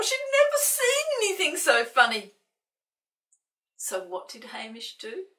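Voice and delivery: ordinary delivery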